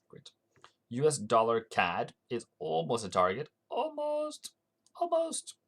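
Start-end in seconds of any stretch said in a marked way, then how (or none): 3.7-4.5 almost squeaked out very high; a silly, much higher squeaky voice; mock-excited falsetto tease; playful squeak. Teasing reaction to near-hit target. 5.0-5.5 almost squeaked out very high again; a silly, much higher squeaky voice; mock-excited falsetto tease; playful squeak. Teasing reaction to near-hit target.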